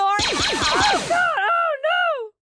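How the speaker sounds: high panicked shout; panicked cry. Acted. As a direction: yelp in sudden panic. Kid hit by laser.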